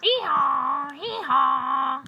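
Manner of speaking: loud, high-starting hee-haw; donkey bray. A narrator's reading. Storyteller voices the donkey practicing his singing, loud bray. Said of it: "And the donkey said".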